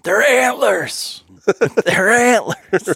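high, slow mock correction, drawn out; pedantic hunter correction. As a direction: imitate the know-it-all who corrects everyone, slowly. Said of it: "people that are at home going".

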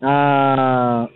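ordinary talk, nothing marked

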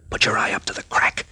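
low unvoiced instruction; whisper. The captain tells Skip to look through the crack, whispering. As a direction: whisper the order close to his ear. Peeking under the curtain.

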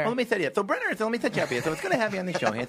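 Jay Leno impression. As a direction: play the host, welcoming a guest onto the show. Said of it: "Hey, Jay Leno".

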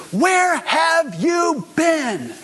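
high, loud, slow furious demand; angry mom's shout. Retold or imitated. Worried mother confronting her son after a scare, angry high shout. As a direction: hurl the question at him like a furious mother. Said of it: "I mean, she was so angry".

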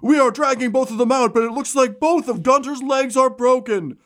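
high, pumped-up ringside call; excited arena announcer. Acted. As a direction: hype the crowd like a fight commentator. Arena announcer calls the fight.

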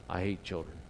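low, soft deadpan grumble; deadpan mutter. Retold or imitated. Rude customer mutters to the cashier, low and deadpan. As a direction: drop it flat and low, unbothered.

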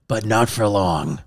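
low, slow, softened foreboding; ominous aside. Acted. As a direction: drop low and slow for the twist. Foreboding twist in the history.

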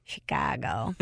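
put-on thick Chicago accent on Chicago. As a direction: say Chicago like a Chicago local. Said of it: "Love a Chicago accent".

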